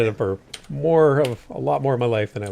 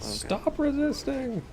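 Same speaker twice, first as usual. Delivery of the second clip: slow, drawn-out cop order, pitched way up; mock cop; 6 dB quieter, much slower than usual. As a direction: bark it like a parody police officer. Mock cop command in game.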